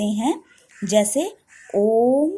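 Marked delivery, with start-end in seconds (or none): 1.8-2.3 ओम with the ओ held long and full; ओम stretched out, ओ held for a long time; drawn-out om. Shown as a demonstration. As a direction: sound ओम as a long pluta vowel. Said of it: "इसमें इस तरह से तीन".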